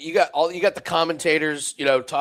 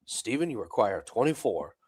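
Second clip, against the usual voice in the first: lightly voiced refined announcer, lower and softer; a hint of a posh darts referee; understated mock-formal score call; sophisticated caller; 7 semitones lower, 6 dB quieter than usual. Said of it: "it sounds a little sophisticated".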